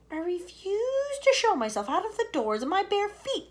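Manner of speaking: high, haughty refusal; fussy centipede. Acted. Centipede refusing to go out barefoot. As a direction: stand on dignity.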